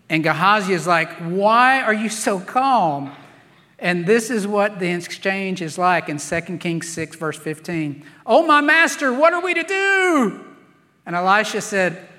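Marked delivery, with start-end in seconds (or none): 1.2-3.1 raised, drawn-out incredulous question; mock exasperation, louder and slow; exasperated servant. Servant to the calm prophet, exasperated disbelief. 8.2-10.4 high, loud mock wail; hammed-up panic, pitch soaring to a held last word; panicked servant. Servant cries to his master before the army, mock panic.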